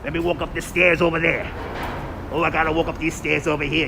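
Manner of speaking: low, casual Brooklyn accent; put-on Brooklyn accent. Shown as a demonstration. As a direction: talk like a Brooklyn local. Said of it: "What about a Brooklyn accent".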